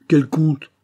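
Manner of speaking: cri de surprise, plus haut; s'écrier, un peu plus fort; exclamation. A narrator's reading. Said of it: "s'écria Hermann". (s'écrier avec surprise)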